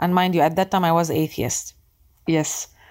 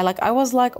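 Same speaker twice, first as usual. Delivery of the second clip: usual speaking voice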